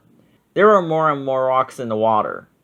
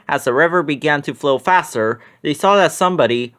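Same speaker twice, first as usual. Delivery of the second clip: ordinary delivery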